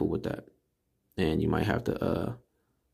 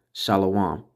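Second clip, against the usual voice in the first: ordinary delivery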